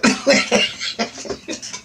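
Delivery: breathy unvoiced laugh. Real, not acted. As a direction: laugh under your breath. Laughing at own joke.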